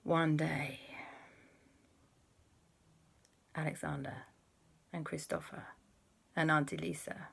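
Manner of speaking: hushed start swelling on the big word; dramatic story opening. Acted. Storyteller opens tale of a trip to Australia, dramatically. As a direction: draw out each phrase with long suspenseful pauses.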